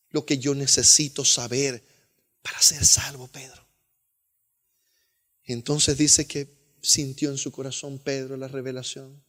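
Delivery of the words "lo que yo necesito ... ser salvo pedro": whisper. Acted. Cornelius asks Peter how to be saved.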